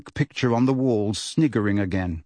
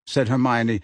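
plain reading voice

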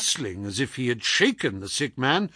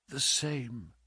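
very soft feeble answer; weak, sickly; 11 dB quieter than usual. Acted. Sick man names his illness to visitor, feebly. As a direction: answer with little strength.

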